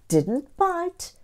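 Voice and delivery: usual speaking voice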